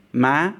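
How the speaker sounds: "ma" climbing in pitch to the end; rising tone. Shown as a demonstration. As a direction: sweep "ma" upward. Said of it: "As a rising tone".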